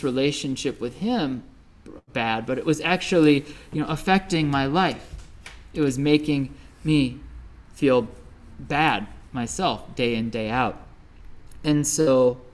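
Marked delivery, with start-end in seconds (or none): none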